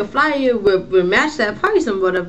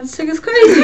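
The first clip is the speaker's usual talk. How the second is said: laughing out the words, higher and louder; 8 semitones higher, 7 dB louder than usual. Real, not acted. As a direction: crack up while saying it. Laughing at the lady's remark.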